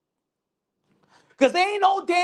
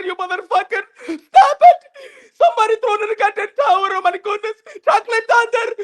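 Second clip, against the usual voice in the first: high, loud, fast sobbing plea; Turkey Ashe crying ringside, hammed-up sob; mock crying wail; 8 semitones higher, 6 dB louder than usual. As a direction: wail like a distraught fan losing it. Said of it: "Turkey Ashe gonna be crying".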